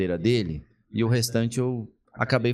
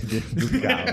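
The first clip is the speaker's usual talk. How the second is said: laughing; 12 semitones higher than usual. Real, not acted. Confessing to the congregation he almost sinned, laughing. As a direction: burst out laughing at himself.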